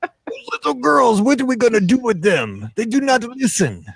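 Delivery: put-on slow, high, hammy Caribbean accent; a bad Caribbean accent, dragged out. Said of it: "That's a very bad Caribbean accent".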